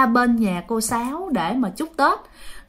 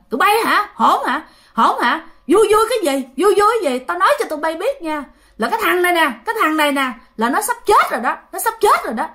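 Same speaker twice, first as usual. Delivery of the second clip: possessed angry shout; 7 semitones higher, faster than usual. Retold or imitated. Possessed father glaring at the whole family, high angry shout. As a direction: bark at the whole family like a spirit in a rage. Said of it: "nó dữ lên, nó long lên thấy ghê lắm".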